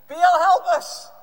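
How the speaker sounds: very loud, high acted shout. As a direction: bellow a drawn-out plea. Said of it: "they start to call out".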